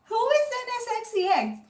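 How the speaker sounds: high, curious quoting of her husband; husband's curious question. Retold or imitated. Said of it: "was like".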